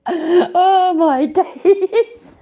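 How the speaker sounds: high, drawn-out words through laughter; laughing. Real, not acted. Streamer overcome by the clip, laughing hard. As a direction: gasp it out while laughing.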